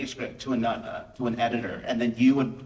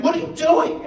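much higher, louder scolding; furious teacher; 7 dB louder than usual. Retold or imitated. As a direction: yank her back with an outraged shout. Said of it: "Like, she's so mad".

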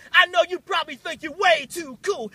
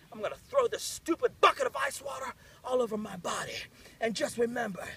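ordinary delivery

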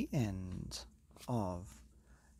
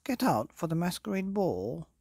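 higher, louder group plea; court's eager call; 8 semitones higher, 6 dB louder than usual. Acted. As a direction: call him out to the ball. Court calls king out.

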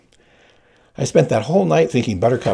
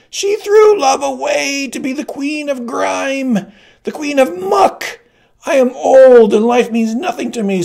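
very high, loud, slow hag voice full of contempt; a withered woman shaming the queen; accusing old crone; 14 semitones higher, 6 dB louder than usual. Acted. Old woman turning to the crowd, high and loud with contempt.

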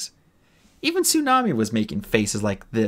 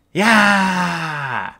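loud, higher mock battle cry; battle yell; 8 semitones higher, 6 dB louder than usual. Clay lunging in the game, loud mock battle cry. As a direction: yell as you lunge. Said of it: "Clay shouted".

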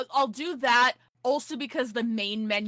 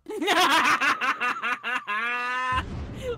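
shouted, high cackle of laughter; loud burst of laughter; 4 semitones higher, 6 dB louder than usual. Real, not acted. Big laugh at the joke.

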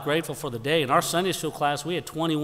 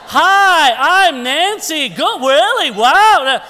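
much higher and louder gushing greeting; gushing mother-in-law; 13 semitones higher, 14 dB louder, much slower than usual. Retold or imitated. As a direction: squeal a cheery hello to a stranger. Mother-in-law greeting plane strangers.

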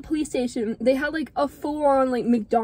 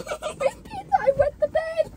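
high, slow, laughing through the words; confessing the punchline while cracking up; laughing confession; much slower than usual. Real, not acted. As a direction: squeal it out mid-laugh.

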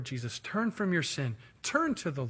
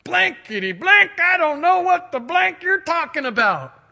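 high, loud bleeped-out outburst; acted angry denial; cursing and swearing; 13 semitones higher, 10 dB louder than usual. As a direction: swear at them at full volume, pitched way up. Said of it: "he began to curse and swear".

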